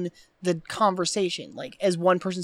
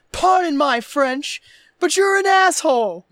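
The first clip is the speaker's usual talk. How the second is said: slow, raised and louder, every word punctuated; punctuated insult; 8 semitones higher, 7 dB louder, slower than usual. Retold or imitated. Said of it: "It's very punctuated".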